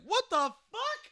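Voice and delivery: high, drawn-out incredulous question; slow, high-pitched bafflement; shocked disbelief. Retold or imitated. Acting out his shocked reaction.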